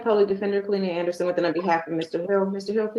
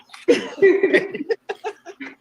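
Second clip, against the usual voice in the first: short real laugh; 11 semitones higher, 5 dB louder than usual. Real, not acted. Defender laughs again.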